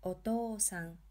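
TO in "Otoo-san" held long; extended "oo" in Otoo-san; long vowel. Shown as a demonstration. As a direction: stretch the "too" of Otoo-san over two beats. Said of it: "we are extending the to syllable".

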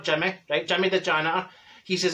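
ordinary talk, nothing marked

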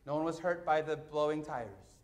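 usual speaking voice

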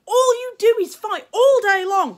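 high, loud exasperated scolding; storming mother. A narrator's reading. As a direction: scold the fighting boys. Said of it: "she stormed".

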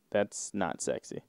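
ordinary delivery

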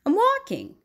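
high, loud grumpy snap; annoyed bird snapping at Raccoon; snapped. A narrator's reading. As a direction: bite the word off at a nosy friend. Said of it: "snapped Bird".

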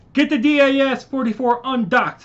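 ordering his brother to undock; urgent shout. Acted. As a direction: bark the order over the battle. Urgent order while fighting.

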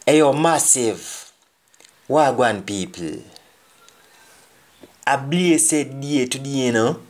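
lightly voiced patois party speech; a hint of a toast at a graduation; speech greeting. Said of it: "somebody's giving a speech".

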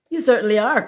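loud, high exclamation; crying out. A narrator's reading. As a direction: call it out as a sharp retort. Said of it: "cried the Bengal light".

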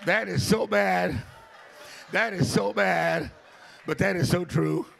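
loud, slow preacher aside; preaching emphasis. Acted. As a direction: hammer it home slowly.

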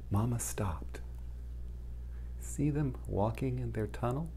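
hushed storyteller whisper, breath only. A narrator's reading. Storyteller whispering to young listeners for suspense. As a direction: lean in and whisper it to the children.

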